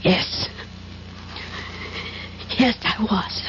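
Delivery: usual speaking voice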